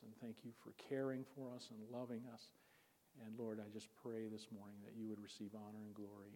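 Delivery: soft, hushed prayer voice. Real, not acted. Preacher praying with the congregation before the sermon, soft prayer. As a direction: pray quietly and earnestly.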